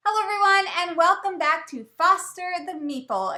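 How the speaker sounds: loud upbeat host intro; bright announcer welcome; channel intro. Acted.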